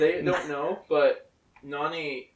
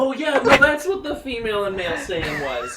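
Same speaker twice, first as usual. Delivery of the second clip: laughing; 9 semitones higher, 17 dB louder than usual. Real, not acted. Laughing about own characters.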